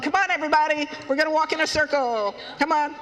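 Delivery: high, lively call to follow along; rallying call. Acted. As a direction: round up the flock cheerfully. Hoopoe leads children in circle.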